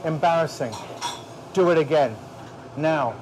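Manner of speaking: furious order. Real, not acted. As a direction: bark it, no discussion. Chef ordering a redo.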